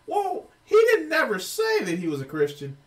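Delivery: high, loud mock-defender voice; mocking imitation. Commentator imitating fans who defend the professor, high and mocking. Mimicking the man's defenders in argument.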